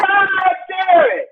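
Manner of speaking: slow, high laughing curse; laughing outburst. Real, not acted. Frustrated fan of Obama, slow laughing curse. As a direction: let it out through laughter.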